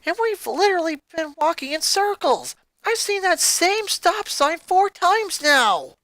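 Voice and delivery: exasperated complaint. Acted. Lori complains.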